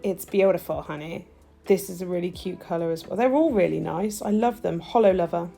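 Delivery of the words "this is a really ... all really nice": ordinary talk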